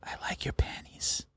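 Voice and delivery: imitating coach's creepy joking whisper; slow, soft whispered tease; whisper in the ear. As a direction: whisper it slowly, fully hushed. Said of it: "he'd whisper in your ear".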